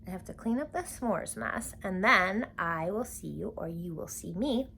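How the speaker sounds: usual speaking voice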